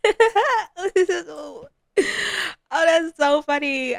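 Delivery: high, loud, drawn-out amused sim; mock amusement. Sim reacting to a couple's mishap, hammed-up amusement. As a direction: stretch it out high as if tickled.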